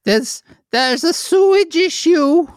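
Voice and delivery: a silly character complaining about sewage; high comic neighbour voice. Pretend. Future neighbour character in falsetto.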